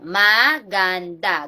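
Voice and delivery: ma-gan-da said slowly, each syllable apart; syllables split apart. Shown as a demonstration. Teacher splitting maganda into syllables for pupils, slow. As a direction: break maganda into ma, gan, da for the class. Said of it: "ang pagpapantig".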